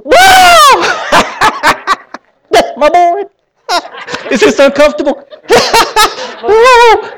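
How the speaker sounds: a father bursting with joy as he hugs his lost son; overjoyed father. Acted. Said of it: "he grabs him up and goes".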